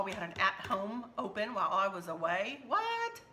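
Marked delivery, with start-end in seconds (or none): 2.7-3.2 surprised. Real, not acted. Sudden interruption mid-sentence.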